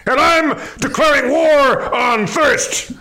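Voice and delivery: barking military commander; drill sergeant. Acted. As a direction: shout orders like a drill sergeant. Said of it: "I'm Major Melon".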